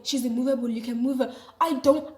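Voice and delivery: usual speaking voice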